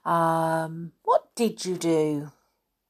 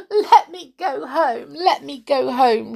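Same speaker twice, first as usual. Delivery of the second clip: tearful pleading, high and loud; weeping; 7 semitones higher, 6 dB louder than usual. Acted. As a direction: plead through tears like a little girl. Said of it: "she wept".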